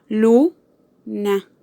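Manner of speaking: "Luna" as LU - na, rising on LU, pausing before na; syllable by syllable. Shown as a demonstration. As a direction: sound out "Luna" in two parts, stressing LU. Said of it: "tiene su acento en la sílaba lu". ("Luna" por sílabas, con acento en LU)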